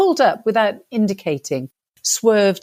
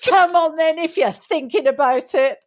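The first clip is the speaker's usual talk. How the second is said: mock challenge; 6 semitones higher than usual. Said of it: "I puffed myself up and said".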